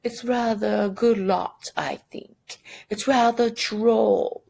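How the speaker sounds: mock upper-class British accent, drawn out at the end; posh British voice. Shown as a demonstration. Teacher mocks a British gentleman in a mild British accent. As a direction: put on a lightly hammed British accent. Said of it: "we don't speak like this".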